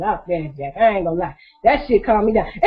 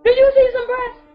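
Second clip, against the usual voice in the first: high mock indignation; 11 semitones higher, 3 dB louder than usual. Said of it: "I said".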